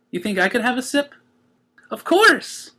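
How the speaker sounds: high, bubbly cartoon pony voice; chirpy excited pony; Pinkie voice. A narrator's reading. Pinkie telling the Cakes how she feels, high and bubbly.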